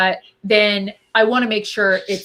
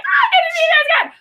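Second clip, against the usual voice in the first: much higher, fast and gleeful; mock excitement; faster than usual. Guest voicing fans thrilled to meet someone, high and fast. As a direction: squeal it like you can't wait. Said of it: "It's like".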